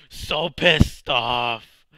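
loud, slow, fuming; furious and loud; angry. Real, not acted. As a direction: drag it out in real anger. Just died losing loot.

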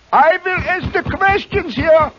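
slow, high German interrogator; mock German accent. Host repeats his punchline, slow and high mock German. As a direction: draw it out in hammed-up German accent. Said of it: "How'd you like my accent?".

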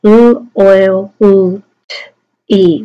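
phonemes of loyalty, each isolated; "L, oil, L, T, E" as speech sounds; firm, loud separate sounds; sounding out. Shown as a demonstration. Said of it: "sound them out".

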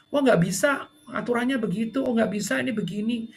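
high, flat refusal; rigid staff. Retold or imitated. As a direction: refuse by the rulebook.

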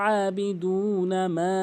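আবিদুনা মা slow, with মা stretched long; slow chanted আবিদুনা মা; long madd. Shown as a demonstration. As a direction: draw out মা after a throaty আবিদুনা. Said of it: "তিন আলিফ টেনে পড়বেন".

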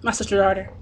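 ordinary talk

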